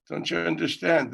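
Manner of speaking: normal talking voice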